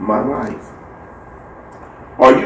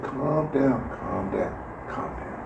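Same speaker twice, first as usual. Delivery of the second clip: fading from soft to a whisper; soothing hush that dies into a whisper; soothing calm down; 15 dB quieter, much slower than usual. Retold or imitated. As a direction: hush her gently, quieter each time. Server calming an upset mother.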